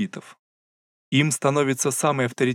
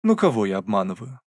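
ordinary delivery

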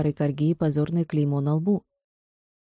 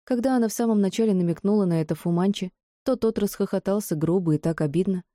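usual speaking voice

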